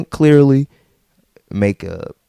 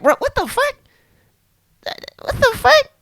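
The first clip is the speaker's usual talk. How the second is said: much higher, incredulous squeal of disbelief. Retold or imitated. Retelling his reaction to an ex's text, high disbelieving squeal. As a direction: react like you can't believe your eyes.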